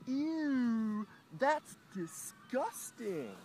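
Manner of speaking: high, loud mock disgust; drawn-out, exaggerated squeal of disgust; mocking another's voice. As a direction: imitate a disgusted heckler, pitched high. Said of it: "I hear a voice".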